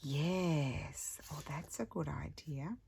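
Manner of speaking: gasp, then whisper the idea to yourself; hushed delight at a sudden idea; breathy secret whisper of a good idea; excited whisper. Real, not acted.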